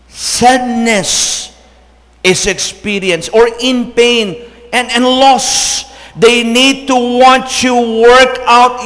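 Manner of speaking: loud, rising, slow sermon voice; full-force preaching. Acted. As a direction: build to a fervent climax. (malakas at maalab na pangangaral)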